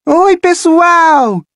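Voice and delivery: líder de torcida animada, aguda e arrastada; saudação esticada e empolgada; efusiva. Acted. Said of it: "disse efusivamente". (líder de torcida saúda os alunos, efusiva)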